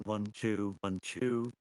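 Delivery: ordinary talk, nothing marked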